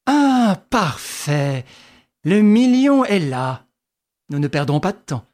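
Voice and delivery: exclaiming. A narrator's reading. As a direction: pounce on it with glee. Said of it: "s'écria".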